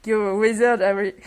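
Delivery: Hagrid's line. Retold or imitated. Quoting Hagrid's famous line to her viewers, mock booming. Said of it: "cette réplique ... d'Hagrid".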